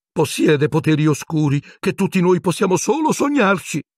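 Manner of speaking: high, piercing Pettigrew squeal; a frightened little man shrieking his case. A narrator's reading. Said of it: "strillò Pettigrew con voce penetrante". (strillo acuto e penetrante di Pettigrew)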